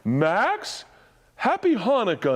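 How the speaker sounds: high, cheery Santa greeting; imitating Santa. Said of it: "Then he'd go".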